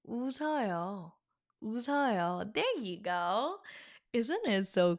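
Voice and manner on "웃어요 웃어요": buttery "웃어요" with the s carried over; smooth like butter. Shown as a demonstration. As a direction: slide through "웃어요" without any break. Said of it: "Smooth like butter".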